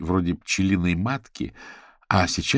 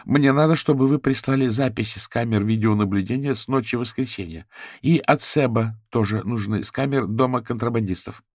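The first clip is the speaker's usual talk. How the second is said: louder, higher firm phone request; insistent, raised-voice instruction; businesslike Fiona; 5 semitones higher, 4 dB louder than usual. A narrator's reading. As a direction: make a brisk demand by phone.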